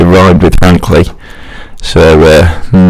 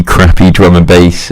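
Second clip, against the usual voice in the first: ordinary talk, nothing marked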